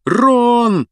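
high, loud desperate shout. Acted. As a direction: yell his friend's name. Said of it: "закричал Гарри".

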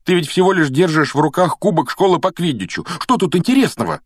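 high, loud protest; indignant. Acted. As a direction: argue back indignantly. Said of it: "возмутился Гарри".

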